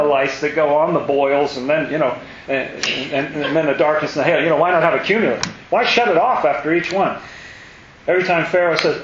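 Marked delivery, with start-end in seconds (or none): none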